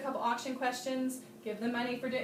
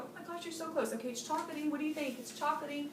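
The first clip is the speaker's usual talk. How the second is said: softer, higher gentle coaxing; encouraging; 3 semitones higher, 4 dB quieter than usual. Shown as a demonstration. Host encouraging a guest who is close, softly. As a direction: coax the guest warmly toward the answer. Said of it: "You wanna go".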